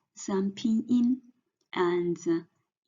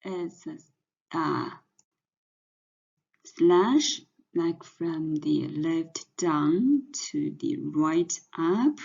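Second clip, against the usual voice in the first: usual speaking voice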